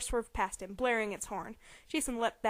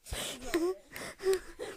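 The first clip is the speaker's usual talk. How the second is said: can't stop giggling; breathy laugh fit; 7 semitones higher than usual. Real, not acted. Reader losing it over the earrings line.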